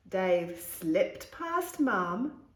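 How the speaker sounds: plain reading voice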